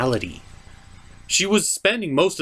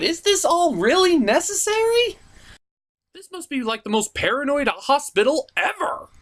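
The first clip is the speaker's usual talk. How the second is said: exasperated disbelief; 11 semitones higher than usual. Real, not acted. Mocking the hospital chase.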